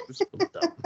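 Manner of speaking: squeaky high voice, scoffing; mock dismissive adult. Mimicking an adult who shrugs off the curse, high scoff. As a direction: pitch it way up and scoff. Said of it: "It's just like".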